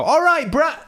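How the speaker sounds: bright, high call-out cut short; cheery greeting. Retold or imitated. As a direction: hail a friend across the shop. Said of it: "I decided that I would go".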